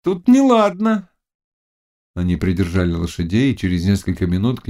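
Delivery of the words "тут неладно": high, slow witch warning; ominous witch. Acted. Witch telling the Cossack the place is bad, high and slow.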